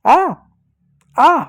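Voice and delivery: sharp high आ आ, playful; mock pain cry. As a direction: yelp as if smacked. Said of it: "कैसे चिल्लाता है". (मार खाने की नकली चीख)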